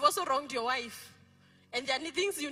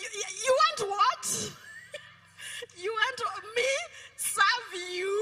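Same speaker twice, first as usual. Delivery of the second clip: mock indignation; 7 semitones higher, slower than usual. Wife retorting to husband demanding food, mock indignant. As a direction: play the wife's disbelief at his nerve.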